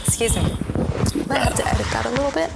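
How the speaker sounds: losing her voice, hoarse and strained. Real, not acted. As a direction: speak through a hoarse, failing throat. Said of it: "Sorry, I'm losing my voice".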